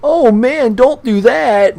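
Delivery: high, slow mock whine of a complainer; mock whiny plea. Mocking his critics in a high, slow whiny voice. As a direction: mimic the pleading crowd, high and drawn out. Mocking what people will say.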